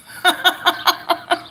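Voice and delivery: high burst of real laughter; amused laugh. Real, not acted. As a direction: crack up at your own joke. Laughs at self-deprecating joke.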